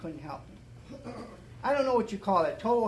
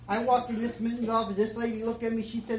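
usual speaking voice

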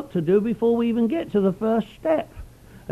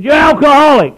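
loud, high, flat insistence; blunt old-timer; 8 semitones higher, 11 dB louder than usual. Retold or imitated. Old-timer insisting to the newcomer, loud and blunt. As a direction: hammer it home to his face. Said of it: "He said".

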